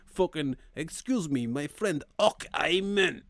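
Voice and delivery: a hammed-up Scottish slip; mock Scottish accent. A friend mimicking him, sliding into a mock Scottish accent. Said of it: "everything becomes Scottish".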